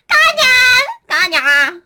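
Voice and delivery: high, loud, drawn-out mock cry; mock wail. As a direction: wail the name like a sulking child. Crying shout for the plush. (甲高く泣き叫ぶふり)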